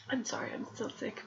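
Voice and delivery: whispering through a cold; a hushed, sick apology. Real, not acted. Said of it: "I'm sick".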